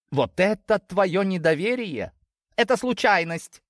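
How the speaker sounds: high-pitched, protesting daughter; indignant daughter. Acted. Daughter defending herself to her family, indignant.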